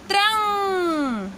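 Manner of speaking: त्रंग stretched very slowly, nasal ending held; drawn-out syllable. Shown as a demonstration. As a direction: sound out त्रंग slowly for the class. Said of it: "उच्चारण हो जाएगा".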